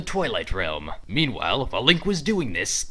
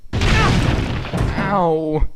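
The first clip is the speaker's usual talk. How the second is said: louder yelp of pain; 4 semitones higher, 6 dB louder than usual. Acted. Link knocked down by Gorons, loud yelp. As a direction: yelp as you get knocked down.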